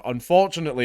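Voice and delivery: normal talking voice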